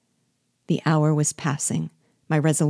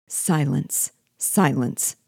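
ordinary delivery

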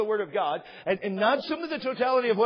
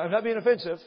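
ordinary delivery

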